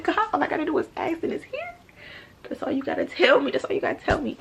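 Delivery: high, loud, laughing amazement; elated prayer. Retold or imitated. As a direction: burst out in delighted disbelief. Acted elated reaction in prayer.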